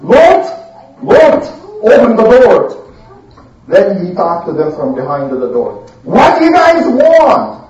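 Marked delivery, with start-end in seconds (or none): 0.0-2.7 loud, slow, drawn-out demands; calling through door. Acted. Bad guys outside a locked door, loudly demanding entry. 6.0-7.6 high, slow challenge; calling back. Acted. Prophet to the men outside, calling out a challenge. Prophet answers through the door.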